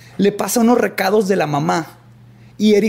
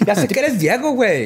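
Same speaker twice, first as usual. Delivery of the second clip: put-on Monterrey fresa accent; 3 semitones higher than usual. Joins the fresa imitation.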